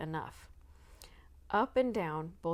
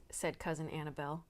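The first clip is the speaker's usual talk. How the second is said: plain reading voice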